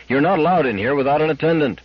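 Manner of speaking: restrained narrated yell; mild yell. A narrator's reading. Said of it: "he yelled".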